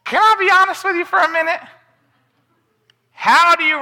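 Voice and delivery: high, quick confiding aside; honest aside. Real, not acted. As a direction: lean in and come clean with them. Confiding in the congregation.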